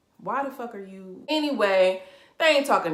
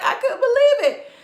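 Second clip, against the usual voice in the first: laughing; 11 dB louder than usual. Real, not acted. Laughing after the kitchen rant.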